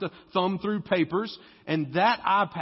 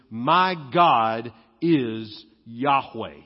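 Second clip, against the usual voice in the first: slow, lower, "my" stressed; emphatic my; 6 semitones lower, 4 dB louder, much slower than usual. Shown as a demonstration. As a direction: proclaim it slowly, leaning on "my". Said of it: "So Elijah's name is".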